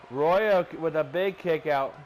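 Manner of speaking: loud excited call; wrestling commentator. Acted. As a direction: burst out on the kick out.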